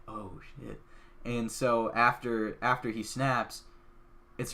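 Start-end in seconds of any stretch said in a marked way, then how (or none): 0.1-0.8 hushed oh shit. Retold or imitated. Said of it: "So I went".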